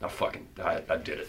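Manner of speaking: low, near-whispered mock awe; very soft, low, halting; hushed self-congratulation. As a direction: murmur it privately to the mirror. Imagined self-talk at the mirror.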